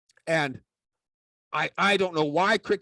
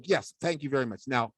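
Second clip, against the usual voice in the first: normal talking voice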